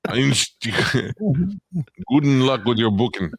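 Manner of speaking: high-pitched mock German accent; hammy German greeting. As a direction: put on a joke German accent, halting with laughter. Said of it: "say it with a German accent".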